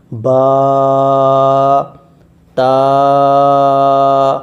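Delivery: با and تا held long, one breath each; slow Quran teacher drawing out each letter; drawn-out letter names. Shown as a demonstration. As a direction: stretch با then تا out slowly. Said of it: "آسان طرح سے پڑھیں".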